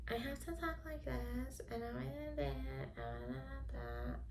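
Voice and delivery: high, soft, mock-feminine voice; mocking a girly voice. Mocking society's ideal woman, high and soft. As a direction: parody the expected ladylike way of talking. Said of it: "I have to be this kind of woman".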